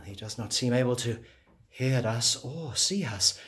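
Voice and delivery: ordinary talk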